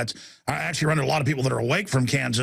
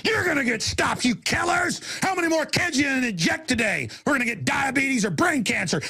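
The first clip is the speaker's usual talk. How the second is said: furious, high-pitched shouted tirade; angry rant; 5 semitones higher than usual. Real, not acted. Radio host yelling at his enemies, furious shouting. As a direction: rage at them at full shout. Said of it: "I try not to get angry".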